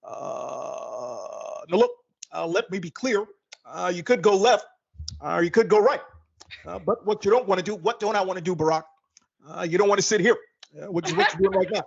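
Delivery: lightly voiced calm, halting Obama cadence; measured and pausing, like a president at a podium; Barack Obama impression. A calm celebrity GPS giving the driver directions, unhurried Obama cadence. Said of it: "Barack Obama has a calm voice".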